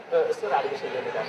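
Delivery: student answering the watchman timidly, low and soft; meek. Retold or imitated. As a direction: shrink back and mumble to the guard.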